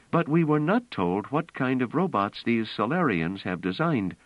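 a woman insisting on the plan; Bliss, urgent and intent. Acted. Bliss urging Pellerat to play robots, earnest.

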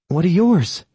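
put-on high, eager young woman. A narrator's reading. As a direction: ask it eagerly. Granuaile voiced by narrator.

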